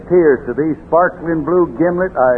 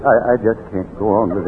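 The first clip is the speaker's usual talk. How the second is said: fast, lower, softer choked-up words; mock sob; 9 semitones lower, 5 dB quieter, much faster than usual. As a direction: fake being overcome with tears. Father overcome by sad memory.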